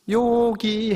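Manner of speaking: put-on high, loud little-kid answer; innocent child. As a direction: imitate a child piping up proudly. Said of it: "순천한 아이들". (순진한 아이처럼 높고 크게)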